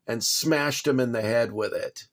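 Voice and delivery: normal talking voice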